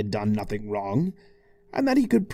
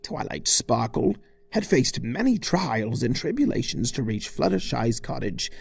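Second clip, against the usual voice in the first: plain reading voice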